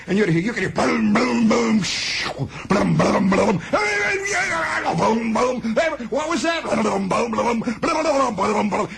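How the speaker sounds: bang out the booms like a crackling line; imitated machine booms. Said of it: "You'd hear, you'd hear in the background".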